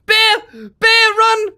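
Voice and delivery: panicked shrieking; breathless fright. Real, not acted. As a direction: scream in panic while fleeing. Fleeing from a bear.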